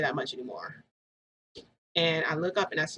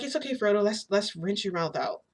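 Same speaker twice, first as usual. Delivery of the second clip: high, sing-song reassurance; soothing baby talk; 4 semitones higher than usual. Retold or imitated. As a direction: coo it to calm a crying child. Soothing her crying baby.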